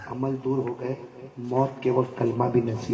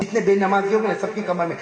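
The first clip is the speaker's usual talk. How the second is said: ordinary delivery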